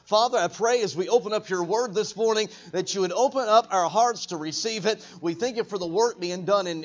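usual speaking voice

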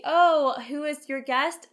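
ordinary delivery

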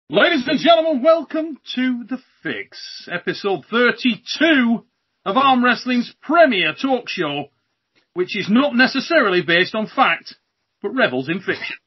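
high, drawn-out show intro; slow, ceremonial host welcome; show announcer. Acted. Host opens the show formally.

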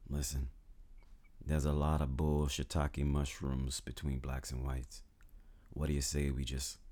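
Lincoln voice. Retold or imitated. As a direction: coax them like a slick dealmaker. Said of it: "His thoughts were".

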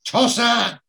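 high, loud mock yell; imitated shout. Bloke yelling out of a car window, imitated shout. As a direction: shout it like an insult from a passing car. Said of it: "leans out the window and shouts".

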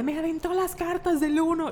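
high, indignant imitation of a friend; mock angry complaint. Imitating Jessica fuming about the UNO cards, mock indignant. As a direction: play up her petty outrage. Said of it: "estaba así como encabronada".

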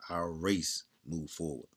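ordinary talk, nothing marked

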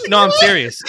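ordinary talk

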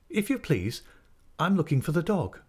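put-on high-pitched, deferential young pupil voice; polite schoolboy. A narrator's reading. Boy explains himself to master.

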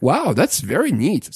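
retold amazement with high pitch swoops; lightly acted wow, lilting upward; impressed. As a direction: imitate someone marvelling at a gadget. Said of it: "she was pretty impressed".

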